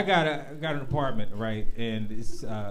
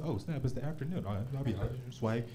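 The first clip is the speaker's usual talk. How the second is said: soft, low groggy shock; groggy afternoon realization; quiet mock surprise; 8 semitones lower, 12 dB quieter than usual. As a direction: murmur it, barely awake. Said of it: "look up and say".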